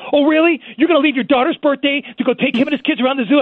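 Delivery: high, loud indignant challenge; pissed-off wife. Retold or imitated. Wife to husband leaving the birthday party, angry. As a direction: imitate a wife incredulous and fuming. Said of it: "she got so pissed at me".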